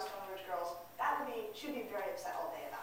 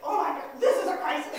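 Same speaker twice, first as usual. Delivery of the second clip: loud, raised-pitch alarm; acted overreaction; parental panic; 5 semitones higher, 11 dB louder than usual. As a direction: blow it up into an emergency. Parent becoming as upset as teen.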